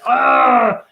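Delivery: short, high, loud cry of pain; mock pain scream. Retelling days of pain, a loud mock scream. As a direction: yelp as if hurting. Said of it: "I'm screaming in pain and I'm like".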